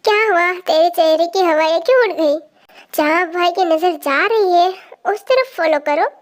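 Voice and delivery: plain reading voice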